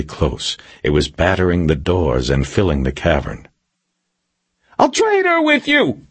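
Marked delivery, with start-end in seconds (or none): 4.8-6.0 high screamed bargain; desperate, very high-pitched yell; Milosevic screaming. A narrator's reading. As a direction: scream the offer through a door. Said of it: "Milosevic screamed through the door".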